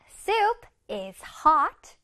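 very slow, each word drawn out; slow model reading. Shown as a demonstration. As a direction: read slowly for the kids to copy. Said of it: "다시 읽어보자".

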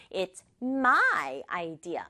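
my drawn out and emphasized; slow line leaning on my; stress on my. Shown as a demonstration. Teacher reads the girl's claim, stressing my. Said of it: "강조를 해서".

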